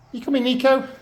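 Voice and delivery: high call to the dog by name; high, bright call to the dog; coaxing call. Real, not acted. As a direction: lure him in with a high voice.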